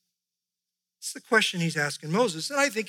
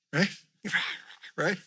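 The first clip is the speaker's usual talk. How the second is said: ordinary talk, nothing marked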